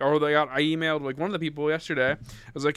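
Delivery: ordinary talk, nothing marked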